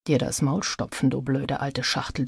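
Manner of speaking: a silent insult kept inside, spoken very softly; thought. A narrator's reading. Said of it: "dachte er".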